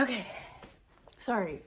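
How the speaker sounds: ordinary talk, nothing marked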